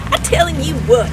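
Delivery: ordinary talk, nothing marked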